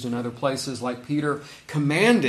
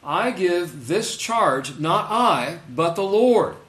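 louder, building to a high, loud "Lord"; emphatic reread; 4 semitones higher, 5 dB louder, much slower than usual. Shown as a demonstration. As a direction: stress "not I" and land hard on "Lord". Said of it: "That's interesting that he says it that way".

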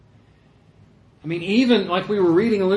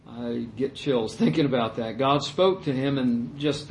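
ordinary talk, nothing marked

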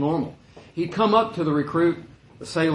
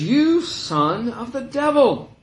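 slow rebuke; 9 semitones higher, 4 dB louder, much slower than usual. Retold or imitated. Paul rebukes Elymas.

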